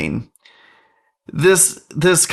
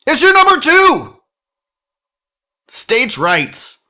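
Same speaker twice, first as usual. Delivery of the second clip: high, loud segment announcement; announcer voice; 9 semitones higher, 4 dB louder than usual. Acted. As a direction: announce it like a game show host.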